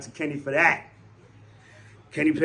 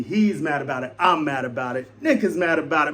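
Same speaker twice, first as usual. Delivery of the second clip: ordinary talk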